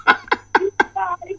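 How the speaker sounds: short real burst of laughter; radio host cracking up at a caller; amused laugh. Real, not acted. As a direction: let a genuine laugh escape.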